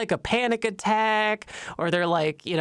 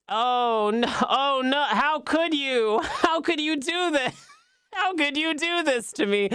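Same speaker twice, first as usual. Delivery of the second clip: high, hammed-up wail of betrayal; mock anguish; 5 semitones higher than usual. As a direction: plead in fake horror, laughter breaking through. Feigned protest at another scan.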